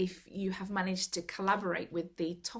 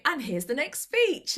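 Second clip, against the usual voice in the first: higher, louder presenter voice; upbeat host announcement; 6 semitones higher, 5 dB louder than usual. Acted. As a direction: announce the next speaker brightly. Acting out her mistaken hosting.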